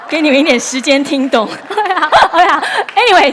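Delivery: can't get through it for laughing; laughing hard. Real, not acted. Preacher cracking up at her own pun, real laughter. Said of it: "OK，好，笑完了吗？".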